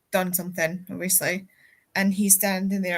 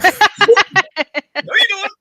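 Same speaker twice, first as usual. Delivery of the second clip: teammate stopping him; 11 semitones higher, 12 dB louder than usual. Retold or imitated. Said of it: "he was like".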